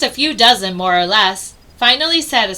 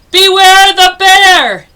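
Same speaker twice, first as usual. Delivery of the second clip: much louder and higher, slow growly call; bear voice coming closer; 9 semitones higher, 13 dB louder, slower than usual. Acted. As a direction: boom it as the bear draws near. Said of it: "The voice came closer".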